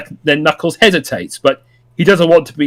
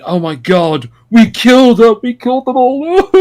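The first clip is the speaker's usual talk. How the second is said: higher, louder, giddy shock; excited Knuckles quote; 8 semitones higher, 3 dB louder than usual. Retold or imitated. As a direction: blurt it out like a stunned kid. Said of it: "and Knuckles saying".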